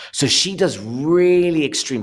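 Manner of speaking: high, loud, stretched vowels; drawn out. Shown as a demonstration. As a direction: exaggerate it, drawing the sounds right out. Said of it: "she draws everything out".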